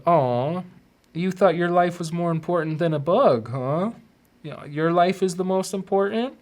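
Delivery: high, teasing sarcastic scolding; mock scolding. Afterlife figure teasing speaker over bugs, high mocking. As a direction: needle him like a smug judge.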